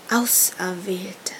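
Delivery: slow, breathless hiss. A narrator's reading. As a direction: hiss the word like a serpent. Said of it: "zischte das Oberhaupt".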